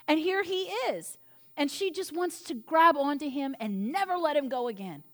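usual speaking voice

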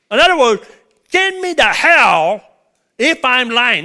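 loud, high, pounding preaching; preacher to congregation, voicing Peter's oath at full force. Preacher acting out Peter's oath, loud and high.

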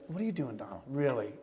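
low, hushed questioning of himself; soft, low retold self-check; quiet self-rebuke. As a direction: question yourself under your breath.

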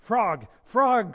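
loud, high excited call; crying out. A narrator's reading. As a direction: call out eagerly for your friend. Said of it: "cried Toad".

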